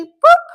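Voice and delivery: high, loud "boop" sound effect; playful boop. Pretend. Host giving Henry the hedgehog five, with a loud boop. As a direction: pop out a sudden high boop.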